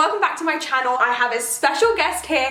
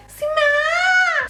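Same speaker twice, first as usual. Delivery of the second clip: high sing-song call across the house; calling out a name; 14 semitones higher than usual. Retold or imitated. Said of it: "You're like".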